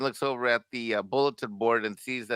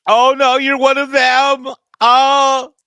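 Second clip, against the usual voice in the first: high, loud, terrified cry of a cornered hostage; panicked pleading; 12 semitones higher, 14 dB louder, much slower than usual. Pretend. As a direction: shriek it out like you've just been caught. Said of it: "you pulled the voice so well".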